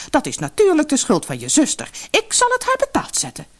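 very high, vengeful outburst; furious mother. Acted. Mother blaming her youngest daughter, high angry threat. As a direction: threaten revenge.

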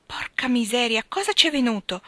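shocked hushed whisper rising into alarm; startled exclamation. Acted. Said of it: "esclamò Joe".

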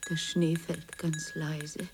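quiet lonely reverie; soft and slow. Acted. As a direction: murmur it to herself, lost. Alone, dejected at night.

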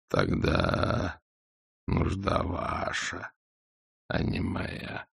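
low, hushed, very slow; cold triumphant dragon. A narrator's reading.

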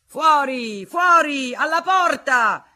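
very high, very loud yell; crowd shouting. Acted. Angry crowd shouting Federico out of the hall, very loud and high. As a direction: roar him out the door.

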